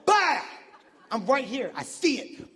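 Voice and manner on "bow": loud, high "bow"; imitated gunshot. Comic re-enacting a rubber bullet shot, loud imitated bang. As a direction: punch out a sudden bang. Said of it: "gets shot with a rubber bullet".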